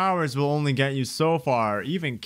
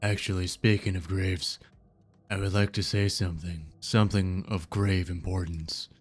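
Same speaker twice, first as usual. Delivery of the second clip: low, solemn voice of the ocean; grave, deep-pitched sea entity; ominous ocean; 6 semitones lower, 4 dB quieter than usual. Acted. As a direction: pronounce doom in a low, even register.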